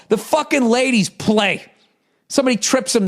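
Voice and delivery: normal talking voice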